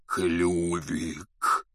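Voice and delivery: husky, choked, drawn-out name; hoarse croak. A narrator's reading. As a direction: croak it out, overcome. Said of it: "прохрипел Хагрид".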